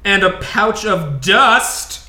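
drawn-out dramatic reveal; slow showy reveal. Pretend. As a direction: present each item like a magician. Pulling items out with flourish.

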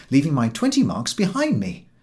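plain reading voice

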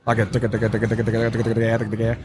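rapid ticka ticka duck chatter; fast feeding call. Shown as a demonstration. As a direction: rattle off a quick feeding chuckle. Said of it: "the feeding call in the air is it can be fast".

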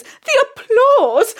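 high, slow, loud rapture; theatrical rapture. Acted. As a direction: savour it like a diva. Theatrical gush.

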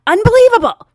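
very loud, high indignant cry; hammed-up exasperated exclamation; mock outrage. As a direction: blurt it as a scandalised passenger. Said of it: "replaying what she just did".

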